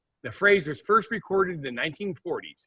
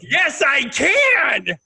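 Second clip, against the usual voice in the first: gleeful triumph; 9 semitones higher, 4 dB louder, much slower than usual. Real, not acted. Gleeful about muting Steve.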